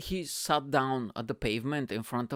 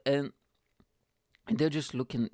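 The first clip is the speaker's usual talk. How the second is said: ordinary delivery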